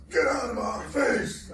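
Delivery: high, loud, slow shouted order; Rambo shout. Retold or imitated. Telling his wife to back off, Rambo-style shout. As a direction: bellow it like an action hero. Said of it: "took the Rambo route".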